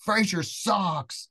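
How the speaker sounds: high, slowly stretched mock jeer; drawn-out put-down. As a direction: drag out the punchline. Retelling the crushing punchline.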